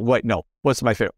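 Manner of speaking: usual speaking voice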